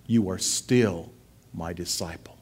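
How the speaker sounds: gentle reassurance. Acted. Jesus reassures failed disciple.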